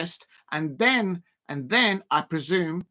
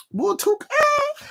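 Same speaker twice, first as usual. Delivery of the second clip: very high indignant cry; squealing back at Damo's comment; mock outraged squeal. Real, not acted. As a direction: yelp it in playful outrage.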